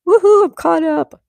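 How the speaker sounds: high, loud little celebration; excited cheer. Real, not acted. Streamer done catching up on chat, cheering. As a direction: whoop it out in triumph.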